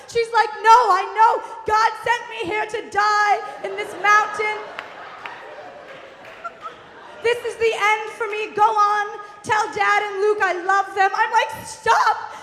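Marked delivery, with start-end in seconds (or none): 0.7-11.1 high, loud deathbed wail; melodramatic screaming. Retold or imitated. Mother sure she will die, hammed-up high wail. Mother declares her dying words. 11.7-12.3 high, loud frantic order; exasperated yell. Retold or imitated. Daughter to panicking mom, high exasperated yell.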